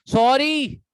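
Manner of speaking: Sorry snapped loud and sharp; harsh sorry. Shown as a demonstration. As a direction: throw sorry out rudely, loud. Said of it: "tone का फर्क है". (Sorry ज़ोर से रूखे लहजे में)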